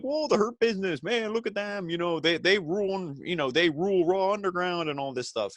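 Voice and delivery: high excited whoa, hyping the Hurt Business; hammed-up Shane McMahon awe; impressed Shane McMahon. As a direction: gush like an awed fan. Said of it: "Shane McMahon's like".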